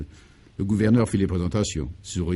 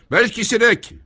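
loud, much higher bellow of a name; 13 semitones higher, 6 dB louder, slower than usual. Acted. The governor summons his servant over the microphone, bellowing. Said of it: "vociféra-t-il dans le micro".

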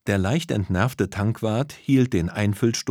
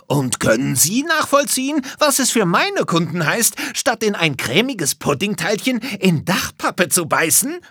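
very high, loud indignant retort; confectioner's high-pitched angry comeback; shouting back; 11 semitones higher, 4 dB louder than usual. A narrator's reading. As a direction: yell back in outrage. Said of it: "schrie der Konditor zurück".

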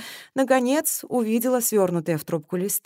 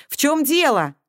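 raised, louder demand; insistent question; 9 semitones higher, 6 dB louder than usual. A narrator's reading. Colonel on the phone demands an answer, insistent. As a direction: demand to know what's happening.

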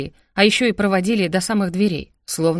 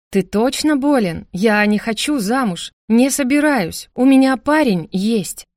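slow, emphatic raised protest; indignant refusal; 4 semitones higher, 4 dB louder, slower than usual. Acted. Sister refusing brother's marriage plan, indignant and emphatic. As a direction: refuse each point louder and higher.

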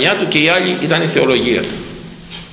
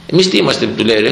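ordinary talk, nothing marked

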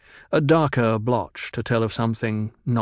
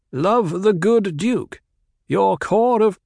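slow, higher, sneering slogan voice; mocking propaganda; 9 semitones higher, 5 dB louder, slower than usual. A narrator's reading. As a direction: parrot the slogans with scorn. Kynes mocks the propaganda slogans.

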